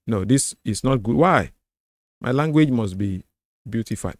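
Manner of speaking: ordinary talk, nothing marked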